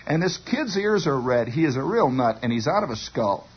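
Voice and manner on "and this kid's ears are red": plain reading voice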